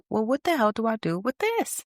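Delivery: high, baffled aside to yourself; exasperated inner voice. Retold or imitated. Rejected again, asking yourself in exasperation. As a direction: throw up your hands, how do I handle this. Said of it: "And you're like".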